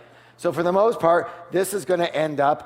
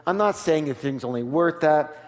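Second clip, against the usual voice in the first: ordinary talk, nothing marked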